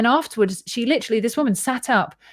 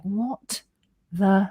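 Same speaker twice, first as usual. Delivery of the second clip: stunned words, spaced far apart; 5 dB quieter than usual. Retold or imitated. Woman alone on her bed after the message, stunned. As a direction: let each word hang in re-enacted disbelief.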